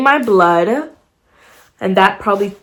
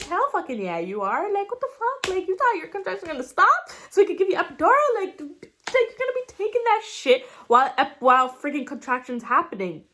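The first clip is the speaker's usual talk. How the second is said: higher, incredulous mock of the nurses; mocking staff; 8 semitones higher, 10 dB quieter than usual. Hospital staff scoffing at the mother's question, higher and mocking. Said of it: "They're like".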